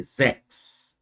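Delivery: "Sechs" starting with a voiced Z. Shown as a demonstration. Teacher modelling the initial Z sound in "Sechs". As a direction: say "Sechs" as "zeks". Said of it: "esse som de Z".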